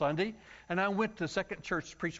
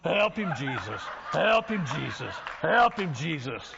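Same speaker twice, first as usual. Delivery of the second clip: church lady plea; 5 dB louder, slower than usual. Retold or imitated. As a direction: beg the Lord from the pew, swinging up and down. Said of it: "start going like this".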